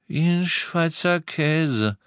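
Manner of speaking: deliberate, slow restating; insistent repeat. A narrator's reading. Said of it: "wiederholte George".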